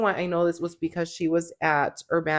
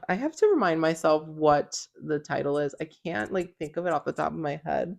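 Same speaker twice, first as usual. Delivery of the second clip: ordinary talk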